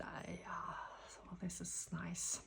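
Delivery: soft unvoiced whisper. Real, not acted. Reader admiring the spread, whispering to herself. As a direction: murmur it under your breath. Whispered aside over the cards.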